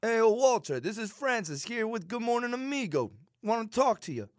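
put-on Italian accent. Cohost rehearses the prank call voice. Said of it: "do you have what it takes".

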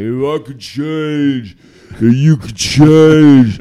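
very slow, swelling impression of a movie speech; Rocky. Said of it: "and he's like".

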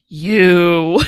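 low, loud mock accusation; upset accusation. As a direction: imitate her upset, land it low and loud. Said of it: "She looks very upset".

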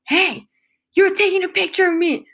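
high, agitated mock accusation; freaked-out accusation. Retelling the passenger catching them photographing him, high freaked-out accusation. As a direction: imitate an outraged stranger calling someone out. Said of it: "this guy freaks out".

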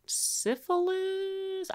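slow, high, incredulous question; drawn-out question. Retold or imitated. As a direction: stretch it out in disbelief. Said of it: "I was like".

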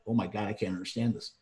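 ordinary delivery

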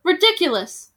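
loud, dismissive outburst; scornful cry. Acted. Bald veteran dismissing the children, loud scorn. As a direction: spit it and turn away. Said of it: "the bald man cried".

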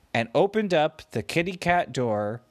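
ordinary delivery

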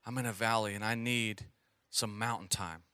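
low, soft, deflated mumble; a worn-out believer sinking into a mock slump; discouraged sigh. Churchgoer imitating a weary believer in a valley, low and deflated. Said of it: "have you ever been in that place where".

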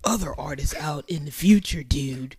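low, mock valley-dude accent; California valley accent. Host joining the parody of the rapper, low valley accent. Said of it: "South Beach, California valley ass accent".

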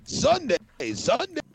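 booming high radio ad hype; monster truck ad. Retold or imitated. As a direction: shout it like a monster truck commercial. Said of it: "fun with sound processing".

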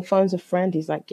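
ordinary talk

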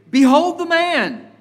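high, loud declaration; Pilate's proclamation. Acted. Pilate presents the prisoner to the people, proclaiming. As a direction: proclaim it high and loud to the crowd, falling at the end. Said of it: "he'll put him before the people and he'll say".

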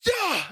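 high, sudden mock scream; imitated scream. Wife screams at husband at the bathroom door, mock scream. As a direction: shriek like a startled wife. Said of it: "she screams at me".

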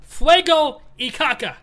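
hammed-up Spanish; mock Spanish. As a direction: roll out Fuego y caca slowly.

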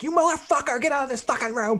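hammed-up furious yell; mock angry yell. Owner yelling at the biting dog, hammed-up. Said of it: "I'm like".

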